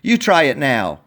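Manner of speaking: plain reading voice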